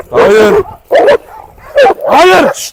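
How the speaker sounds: panicked yelling at dogs; alarmed shouting. Real, not acted. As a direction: bark commands to stop the fight. Stopping dogs again.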